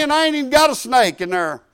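ordinary talk

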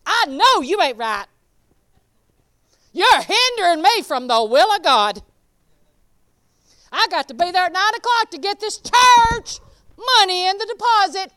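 loud, fiery rebuke aimed at the devil; rebuking the devil. Retold or imitated. Preacher acting herself rebuking the devil in a loud, fiery shout. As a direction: cast the devil out, shouting at him. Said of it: "then when he sets it up".

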